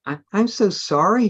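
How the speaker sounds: high, timid apology; a shy boy apologising, voice rising. A narrator's reading. Reading to friends, a shy vampire boy apologises timidly. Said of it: "he said in a shy voice".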